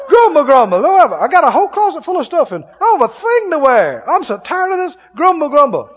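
high, hammed-up griping; mock grumbling. Complainer facing a full closet, high mock grumbling. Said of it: "Stumble and grumble".

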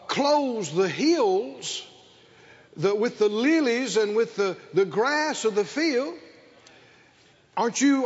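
normal talking voice

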